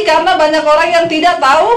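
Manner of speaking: high, emphatic call with rising end. Shown as a demonstration. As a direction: prompt the crowd to finish it loudly. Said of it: "Yang kenceng ngomongnya".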